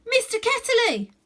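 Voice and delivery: high loud shout of recognition. A narrator's reading. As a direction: call out the name as memory returns. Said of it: "she shouted out".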